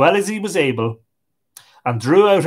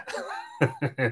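eerie quiet laugh; 10 dB quieter than usual. A narrator's reading. Corpse laughs after speaking.

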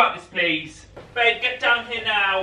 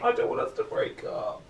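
whisper; 10 dB quieter than usual. Acted. Upset boyfriend pleading.

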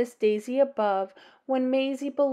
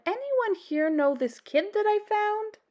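high, carrying question to the room; officer asking the room; 10 semitones higher than usual. Acted. Officer asking the crowd who the kid belongs to, high and lifted.

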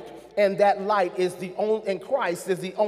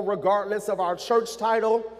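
usual speaking voice

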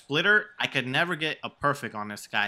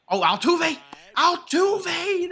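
high, loud shout of the player's name; excited cheer; 14 semitones higher, 6 dB louder, much slower than usual. Real, not acted. Gamer cheering his fielder's great play, excited shout. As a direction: cheer the catch at the top of your voice.

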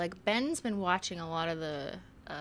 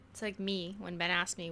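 ordinary talk, nothing marked

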